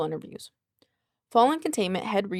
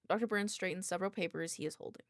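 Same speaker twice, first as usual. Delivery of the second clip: ordinary delivery, nothing marked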